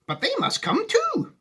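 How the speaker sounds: high, earnest Wonka voice; serious Wonka. Acted. Wonka answering Charlie seriously, in a high voice.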